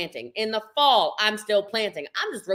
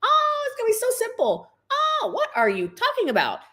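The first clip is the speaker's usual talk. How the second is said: much higher mocking voice, a hammed-up easy-money pitch; mock hype promise. Telling recruits what she'll never say, in a much higher mocking voice. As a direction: parody a hyped recruiter's promises. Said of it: "you are never gonna hear from me".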